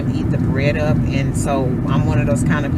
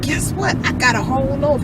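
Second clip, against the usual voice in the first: playful reveal; 9 semitones higher than usual. Retold or imitated. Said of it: "And I said".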